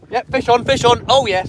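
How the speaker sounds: loud, high cheer as the fish bites; excited. Real, not acted. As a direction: shout it out with joy.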